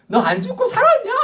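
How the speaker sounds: high, sneering with a laugh; mocking curse. Shown as a demonstration. Preacher showing a cursing greeting, high and mocking. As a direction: taunt them for surviving. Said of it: "저주하는 말이에요".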